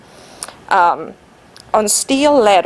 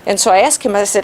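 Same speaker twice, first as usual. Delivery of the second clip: ordinary talk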